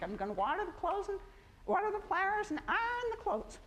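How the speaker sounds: put-on quoting home folks in a high country accent. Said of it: "the country accent I grew up with".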